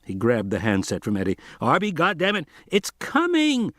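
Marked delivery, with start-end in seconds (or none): 1.6-2.5 angry. A narrator's reading. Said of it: "Thorne turned purple with anger". 2.7-3.7 high drawn-out panicky cry; panicked. A narrator's reading. Eddie panicking.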